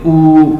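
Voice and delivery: a long drawn "ऊ" from the back of the tongue; long oo demo. Shown as a demonstration. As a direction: stretch the "ऊ" out. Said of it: "आप feel करें ज़रा".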